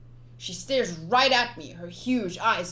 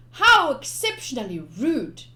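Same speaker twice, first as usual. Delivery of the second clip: mock offended; 6 semitones higher, 8 dB louder than usual. Real, not acted.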